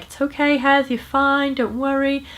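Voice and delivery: easygoing reassurance. Retold or imitated. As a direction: reassure him gently like an easygoing mum. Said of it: "been like, easygoing".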